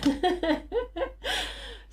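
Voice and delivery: high squealing laugh. Real, not acted. Laugh after exclamation.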